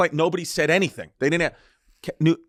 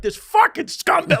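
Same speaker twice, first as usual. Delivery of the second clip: much higher, slow, put-on fuming attendant; muttered insult; slower than usual. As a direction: spit it out like the seething attendant. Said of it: "she was like".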